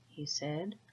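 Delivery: plain reading voice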